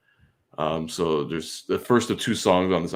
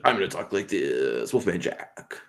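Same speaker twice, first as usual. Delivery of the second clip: Wolfman Jack; 3 dB quieter than usual. Retold or imitated. Imitating the Wolfman Jack radio voice, raspy DJ. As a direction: growl it like a late-night DJ. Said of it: "Wolfman Jack came and said".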